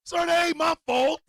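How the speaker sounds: high, loud put-on Cockney; mock Cockney. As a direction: read it out in a mock Cockney accent. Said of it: "He over there writing in Cockney".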